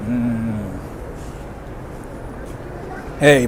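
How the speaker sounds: ordinary delivery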